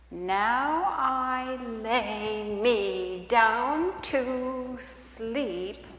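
put-on very slow, sing-song child's prayer; childlike bedtime prayer. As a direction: recite it like a small child at bedtime. Skit, wife tries a child's prayer.

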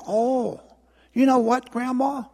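high, put-on innocent voice; scheming little sister. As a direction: pipe up with mock innocence. Acted sister sweet-talks her grandmother.

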